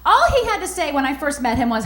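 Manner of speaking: ordinary talk, nothing marked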